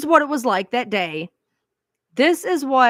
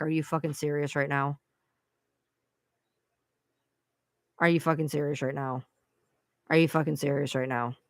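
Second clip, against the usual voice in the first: exasperated disbelief; 5 semitones lower, 7 dB quieter than usual. Real, not acted. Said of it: "she's back".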